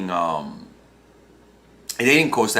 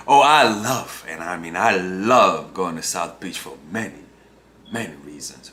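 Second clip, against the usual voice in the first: saying the opposite; 7 semitones lower than usual. Shown as a demonstration. Example of loving South Beach, insincere and suggestive. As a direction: let the low voice undercut the love. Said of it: "saying the opposite with your words".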